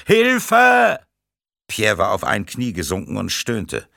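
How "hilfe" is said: loud, high-pitched cry for help; scream. A narrator's reading. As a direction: scream for rescue at full voice. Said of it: "und schrie". (lauter, hoher Hilfeschrei)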